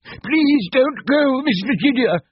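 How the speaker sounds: high, slow pleading cry. A narrator's reading. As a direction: plead with her, drawn out. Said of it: "he cried".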